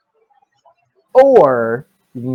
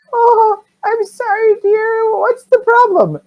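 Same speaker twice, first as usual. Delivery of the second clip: put-on very high, loud old-lady voice; hammed-up sugary granny; sweet old lady; 5 dB louder, slower than usual. Sweet old lady asking a child what's wrong, old lady voice. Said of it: "this sweet old lady's voice".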